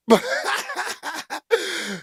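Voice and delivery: short amused laugh; chuckling at the chat. Real, not acted. Laughing at the comment.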